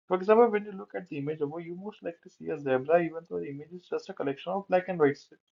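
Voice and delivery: slow, careful reading from a slide; slow text reading. Real, not acted. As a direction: read it word by word. Reads slide text aloud. (धीरे-धीरे पढ़ना)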